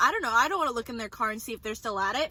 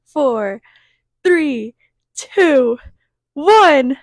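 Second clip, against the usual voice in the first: counting down, louder each number; shouting the countdown to midnight; building excited countdown; 2 semitones higher, 17 dB louder, much slower than usual. Real, not acted.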